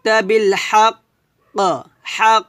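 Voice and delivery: হাক ending in a loud bouncing ক; big qalqala. Shown as a demonstration. Teacher showing strong qalqala on হাক. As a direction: snap the final ক so it echoes. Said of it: "এটাকে বলা হবে বড় কলকলা".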